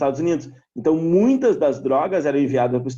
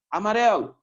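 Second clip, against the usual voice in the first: "Amarelo" as a one-word dog command, pitched up; command intonation; 6 semitones higher than usual. Shown as a demonstration. Teacher demonstrating a ball command to a dog, "Amarelo" in raised intonation. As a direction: call "Amarelo" higher, set apart by pauses. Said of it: "a tua entonação de voz".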